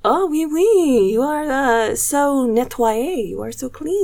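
high, slow hammed-up French accent; mock French accent. Podcast host imitating French women, mock French accent. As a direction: play a snooty French woman in a French accent. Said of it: "I can't do a French accent right now".

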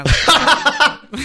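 loud laughing boast; laughing loudly. Real, not acted. Joking to the hosts about a spa day, laughing out loud. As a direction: crack herself up mid-line.

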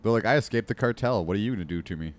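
ordinary talk, nothing marked

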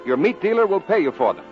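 ordinary talk, nothing marked

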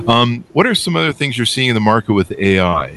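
ordinary delivery

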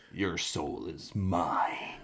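slow, whispered villain line; creepy whisper. Pretend. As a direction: breathe it out like a sinister sorcerer. Mortal Kombat villain quote.

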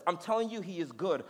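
ordinary talk

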